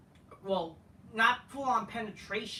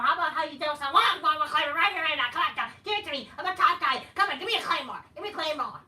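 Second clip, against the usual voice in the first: high, loud hammed-up pleading; mock goading yell; 6 semitones higher, 6 dB louder, faster than usual. Wrestler begging for one more Claymore, mock excited shouting. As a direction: egg the opponent on like an over-eager tough guy.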